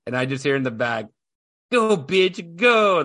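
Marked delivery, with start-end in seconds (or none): 1.7-3.0 lightly voiced little kid, higher and louder; imitating his little son. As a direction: mimic a small boy blurting it out. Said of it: "my son out of nowhere just says".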